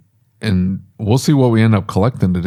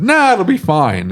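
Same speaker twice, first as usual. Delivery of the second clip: retold breezy brush-off, higher and drawn out; dismissive; 8 semitones higher, slower than usual. Shop owner retelling his reply to the worried manager, dismissive. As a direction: wave the worry away with a long, high "nah".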